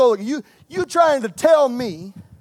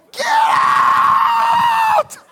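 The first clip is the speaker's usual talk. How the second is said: much higher, shouted with laughter; Jesus shouting; 4 dB louder than usual. Retold or imitated. Jesus driving sellers out of the temple, shouting. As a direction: bellow it like clearing the temple. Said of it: "Took out a whip".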